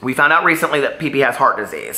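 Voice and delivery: normal talking voice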